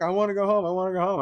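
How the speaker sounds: high, pleading mock cry. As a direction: imitate an upset child begging. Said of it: "I'm, like, crying".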